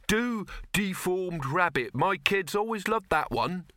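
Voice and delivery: high, slow demanding voice; demanding woman. Acted. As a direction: call out a request. Second woman requests.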